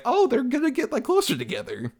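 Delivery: high, put-on mock cheer; mocking the family's matchmaking hopes in a high sing-song; mock hopeful voice.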